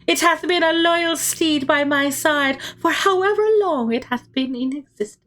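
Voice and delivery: high-pitched heroine begging her allies; Don Quixote's high, frantic cry. Acted. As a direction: cry out high and desperate like a young knight. Don Quixote praises her steed.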